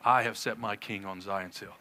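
low, soft, measured divine pronouncement; lightly voiced majesty, pitched well down; majestic voice of God. Preacher voices God answering the raging nations, low and majestic. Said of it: "you hear God's majestic voice".

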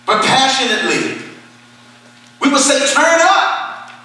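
high, impassioned pulpit appeal; full-force preaching. Real, not acted. Preacher urging the church to go out and plead, fervent preaching. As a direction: implore them with mounting urgency.

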